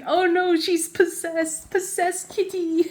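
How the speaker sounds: high, playful mock horror; mock alarm. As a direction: cry out in pretend panic about the cat. Acted alarm at cat photo.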